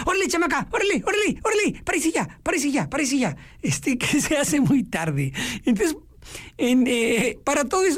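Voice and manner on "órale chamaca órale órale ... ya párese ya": put-on mother hurrying a sleepy girl, much higher-pitched; mother rousing daughter. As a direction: nag her out of bed: órale, párese ya. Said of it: "Entonces la mamá dice". (mamá apurando a la hija dormilona, voz mucho más aguda)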